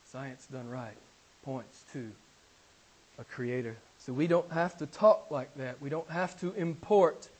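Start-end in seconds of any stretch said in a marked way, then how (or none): 0.1-3.8 hushed restatement. Real, not acted. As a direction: drop to a near hush to let it sink in. Soft restatement after loud climax.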